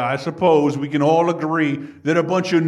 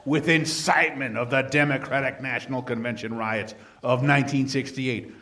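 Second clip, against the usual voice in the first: ordinary delivery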